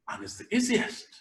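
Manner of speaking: easiest rising high and soft; high, soft rise on easiest. Shown as a demonstration. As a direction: pitch easiest up high, softly. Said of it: "I'm going to tell you just one".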